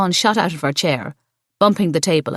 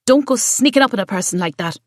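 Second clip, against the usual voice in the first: scolding; 5 semitones higher than usual. A narrator's reading. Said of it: "Siobhan scolded".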